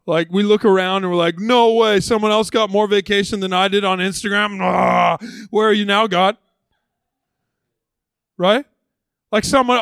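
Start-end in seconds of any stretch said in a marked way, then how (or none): 1.4-6.4 mock whining complaint. Mimicking envious scrolling complaint.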